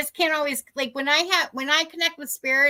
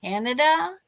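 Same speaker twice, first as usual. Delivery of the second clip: Canadian accent with rising end. Shown as a demonstration. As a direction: lightly put on a Canadian accent. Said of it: "I pick up accents of people from different countries".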